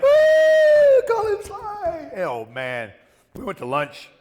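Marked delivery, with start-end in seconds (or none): 0.0-2.1 high, loud, slow triumphant shout; excited whoop. Retold or imitated. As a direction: whoop it like you just won. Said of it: "I was like".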